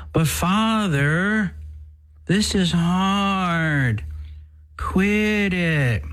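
high, slow whining complainer; whiny voice. Retold or imitated. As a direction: whine it like a sulky child. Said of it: "that whiny voice".

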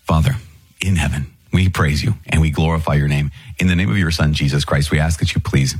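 normal talking voice

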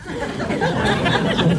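high amused cackle; real laugh. Real, not acted. Preacher laughing after calling the husband a rat, high laugh. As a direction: burst out laughing.

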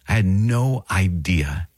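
drawn out, NO stressed; slow it down, lean on no; I had no idea, slowly, with no stressed; slow and emphatic. Shown as a demonstration.

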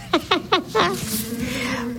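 soft, high run of chuckles; amused laugh. Real, not acted. Speaker amused at Don Bosco's failed grab, soft high laughter. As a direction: giggle lightly at the story.

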